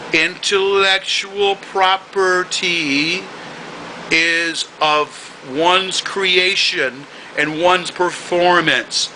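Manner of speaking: very slow, drawn-out words; said slowly. Shown as a demonstration. Lecturing the man who stole his videos, very slow. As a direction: spell it out slowly like to a child. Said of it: "So I'm gonna say it slowly".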